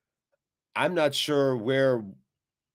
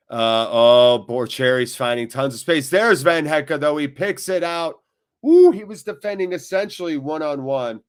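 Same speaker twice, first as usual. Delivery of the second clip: excited; 3 semitones higher, 7 dB louder than usual. Real, not acted. Attack develops, rising excitement.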